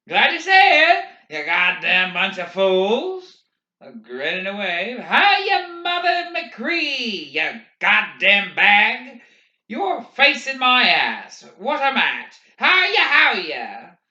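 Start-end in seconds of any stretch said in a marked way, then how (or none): 0.1-3.4 loud, high hail to a crowd; calling out. A narrator's reading. Walker greeting the spectators with curses, calling out. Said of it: "calling in his flat Midwestern accent". 5.0-14.0 high, loud mock-friendly hollering; calling out. A narrator's reading. Waving walker cursing spectators roundly, calling out. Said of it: "calling in his flat Midwestern accent".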